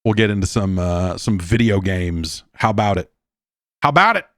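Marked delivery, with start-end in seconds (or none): 3.8-4.3 higher, louder, rising hype; excited call. Shown as a demonstration. Host hyping the break, louder and higher. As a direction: pump up the audience. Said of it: "repeated call".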